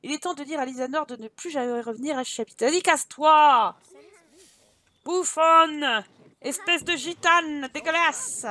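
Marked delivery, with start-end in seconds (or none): 2.6-8.5 loud jeering shout; playful loud heckling; mock angry yelling. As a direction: hurl insults after someone being chased off. Yelling insults at banished game character.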